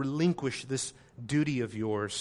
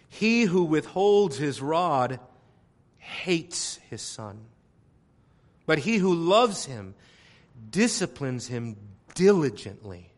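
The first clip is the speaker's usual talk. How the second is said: scripture reading; 4 dB louder, slower than usual. Acted. Reading proverb.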